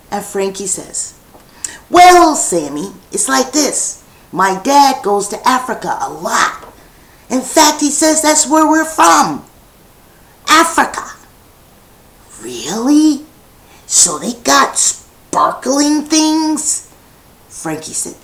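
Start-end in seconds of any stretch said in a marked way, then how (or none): 1.9-11.1 put-on child's voice explaining. Frankie explains the diamonds. 12.4-16.9 put-on amazed child. Sammy asks, amazed.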